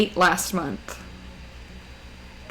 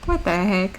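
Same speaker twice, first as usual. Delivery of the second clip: ordinary talk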